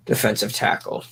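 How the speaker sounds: ordinary talk, nothing marked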